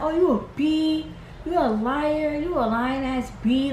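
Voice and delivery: high, drawn-out name-calling; mock going off. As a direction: imitate someone telling a person off. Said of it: "she's just going off at everybody".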